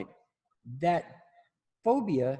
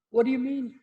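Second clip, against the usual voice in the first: higher, innocent-sounding voice; mock puzzled; 11 semitones higher, 4 dB louder than usual. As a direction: play dumb in a raised voice. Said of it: "So what are you doing?".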